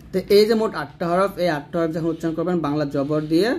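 ordinary talk, nothing marked

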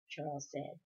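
ordinary delivery

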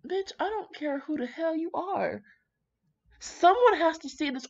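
high, loud sassy rant. Real, not acted. Reader telling off the secretive bride, high loud sass. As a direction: tell her off, all attitude.

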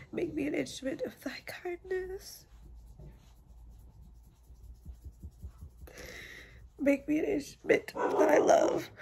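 prayer. Real, not acted. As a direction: pray it out, rising in fervor. Says a prayer to God.

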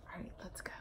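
hushed whisper. Real, not acted. Teacher whispering to children before the story. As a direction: lean in and whisper it. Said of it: "whispered before starting".

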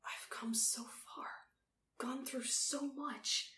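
slow, stricken whisper. A narrator's reading. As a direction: whisper it slowly, word by word. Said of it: "he whispered".

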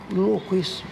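usual speaking voice

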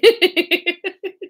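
high giggle; real helpless high laugh. Real, not acted. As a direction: burst into high-pitched giggles. Laughing at listeners' teasing.

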